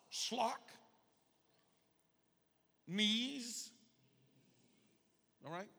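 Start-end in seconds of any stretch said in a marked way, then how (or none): 0.1-3.7 "Slock", pause, "Knees", each higher and separate; word-by-word modelling. Shown as a demonstration. Preacher leading a repeat-after-me, slow separated syllables. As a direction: sound out "Slock" and "Knees" for a class to echo. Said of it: "Say that with me".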